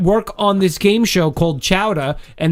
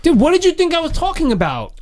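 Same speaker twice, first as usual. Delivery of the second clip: high-pitched exasperated dude; 13 semitones higher than usual. Real, not acted. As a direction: throw the question up in disbelief. Exasperated at co-host.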